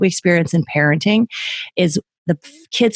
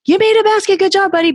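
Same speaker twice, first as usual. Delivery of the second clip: much higher, gushing praise; grandparent praise; 12 semitones higher, faster than usual. Retold or imitated. Grandparents gushing over the kid's first basket, high and excited. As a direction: cheer the kid on like a proud grandparent. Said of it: "the grandparents are like".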